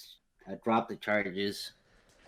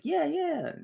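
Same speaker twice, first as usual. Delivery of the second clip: mock high yeah. Woman voiced answering police.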